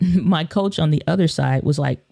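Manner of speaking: ordinary delivery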